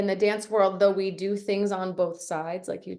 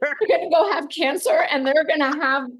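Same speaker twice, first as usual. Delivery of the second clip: loud, high mock indignation; hammed-up outraged whine; mock outrage; 7 semitones higher, 6 dB louder than usual.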